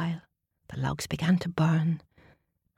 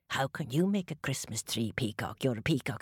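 low, friendly dog voice; a cosy, content dog; 5 semitones lower, 6 dB quieter than usual. Acted. Dog doubting.

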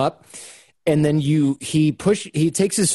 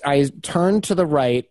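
ordinary delivery

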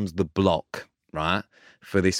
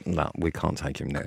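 low, soft word on the side; confidential aside; 5 semitones lower, 7 dB quieter than usual. Retold or imitated. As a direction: imitate a discreet refusal to a mate, kept down. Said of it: "pulled me to the side".

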